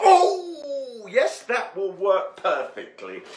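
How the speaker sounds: high, loud delighted "oh", then settling; very excited. Real, not acted. Cook pleased at using up last bits, excited. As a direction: burst out, thrilled by a small find. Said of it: "I get very excited about".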